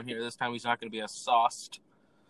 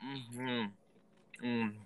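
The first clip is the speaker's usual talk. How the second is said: talking around a candy; sucking a hard candy; 3 semitones lower, 8 dB quieter, slower than usual. Real, not acted. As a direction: mouth full of candy. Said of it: "Sorry, I have candy in my mouth".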